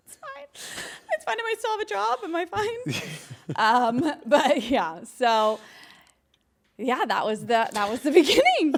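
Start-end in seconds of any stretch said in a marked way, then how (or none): 0.1-3.1 nervous reassurance. Retold or imitated. Panicked reply to Vince.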